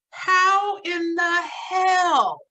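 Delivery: loud, drawn-out disbelief, pitched high; incredulous outburst. Acted. Reviewer balking at the robot twist, incredulous outburst. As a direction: stretch it out in exasperated disbelief. Mock outrage at the plot twist.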